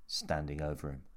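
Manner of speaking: plain reading voice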